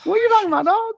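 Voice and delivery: shrill-high, loud quoted outburst; high-pitched friend impression. Imitating his best friend from Brooklyn, high-pitched and loud. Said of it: "why you're talking so high pitch".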